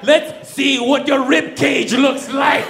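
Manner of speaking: hammed-up knife-wielder's threat; mock menace. Guard threatens man with big knife, hammed-up slow menace.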